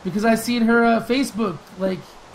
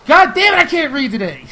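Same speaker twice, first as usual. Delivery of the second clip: frustrated outburst; 6 semitones higher, 9 dB louder than usual. Real, not acted. Stumbling while reading a viewer question.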